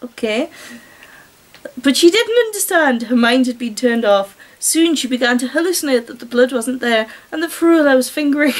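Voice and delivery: normal talking voice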